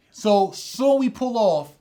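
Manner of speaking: normal talking voice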